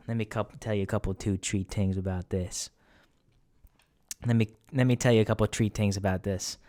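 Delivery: mock Italian-American mobster, th turned to t; Soprano talk. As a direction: rush in, then land it like an Italian-American wise guy. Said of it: "I could go into Soprano talk".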